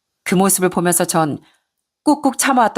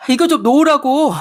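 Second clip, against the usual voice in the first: higher, louder cry to let go; pained shout; 7 semitones higher, 4 dB louder than usual. Acted. Son shouting at mother to let go. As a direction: yell at her to let go. Son's hair pulled.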